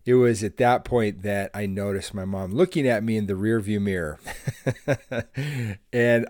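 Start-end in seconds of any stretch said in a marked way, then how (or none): none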